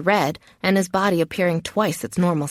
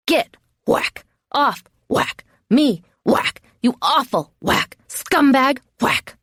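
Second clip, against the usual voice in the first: "Get", "Off", "Me", "Scumbag" each punched high, followed by "whack"; every word emphasized; 5 semitones higher, slower than usual. A narrator's reading. Captive beating attacker off, "Get", "Off", "Me" each emphasized. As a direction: hit each word separately, from "Get" to "Scumbag", a blow after each. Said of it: "I emphasize every word that comes out".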